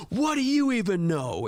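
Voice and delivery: high-pitched imitation of an upset student; retold angry yell. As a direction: lash out in a mock teenage yell. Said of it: "why'd you yell at me".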